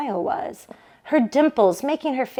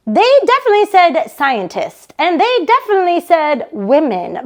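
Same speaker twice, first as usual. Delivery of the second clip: louder, higher, eager big sister pushing the news; Barbara urging; 7 semitones higher, 6 dB louder than usual. Acted. Sister on the phone insisting NASA wants women, eager and loud. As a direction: sell it like an insistent older sister.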